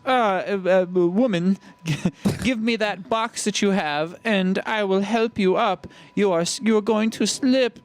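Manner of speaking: hammed-up German villain offering help; mock German accent. Villain calling to the woman on the ladder, mock German accent. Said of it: "We keep doing his voice in a German accent".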